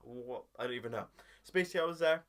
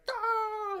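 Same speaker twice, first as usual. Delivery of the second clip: high, loud drawn-out "ah" wail; hammed-up falsetto scream; exaggerated comic yell; mock shriek; 6 dB louder than usual. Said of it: "Why are you shouting at me".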